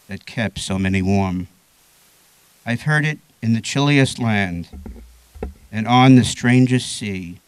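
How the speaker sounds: slow, measured recital with pauses between lines; poetry reading. Acted. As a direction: recite it deliberately, leaning into the key words. Reads a short poem aloud.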